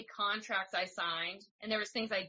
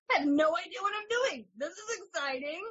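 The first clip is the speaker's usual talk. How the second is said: excited; 9 semitones higher, 3 dB louder than usual. Retold or imitated. Voicing toddler-parent panic.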